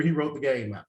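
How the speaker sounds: normal talking voice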